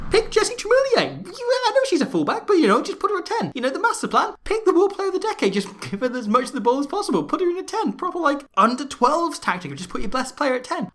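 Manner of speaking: the coach's big idea in a high hammed-up voice; mock coach voice. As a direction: gleefully pitch the silly master plan. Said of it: "Hérault had another brilliant idea".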